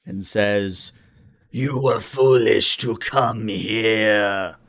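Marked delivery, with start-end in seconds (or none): 1.5-4.6 deep reptilian voice. Acted. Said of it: "a very deep and low voice".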